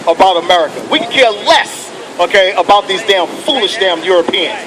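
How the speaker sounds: normal talking voice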